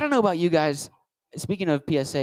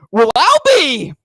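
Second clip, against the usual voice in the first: high, loud, slow country singer voice; country will.i.am; 10 semitones higher, 10 dB louder, slower than usual. Acted. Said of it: "This next character is a country version of will.i.am".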